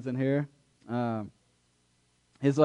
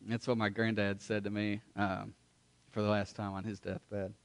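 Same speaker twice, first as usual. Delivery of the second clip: ordinary talk, nothing marked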